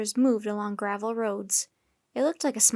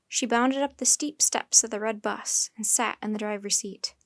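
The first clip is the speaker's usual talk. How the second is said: ordinary delivery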